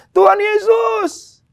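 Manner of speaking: high, loud, slow cry for a miracle; imitating a friend's desperate screamed prayer; retold pleading shout. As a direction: beg God at full volume, drawing the words out. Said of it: "dia teriak".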